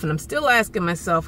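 usual speaking voice

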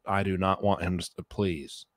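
ordinary talk, nothing marked

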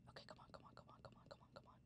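barely voiced urgent whisper. Real, not acted. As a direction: urge it on under your breath. Hiding, urging under breath.

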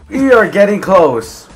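high, loud, stretched words; high and loud, slightly drawn out; excited announcement. Real, not acted. Building suspense in game play.

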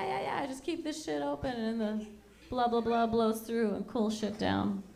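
ordinary talk

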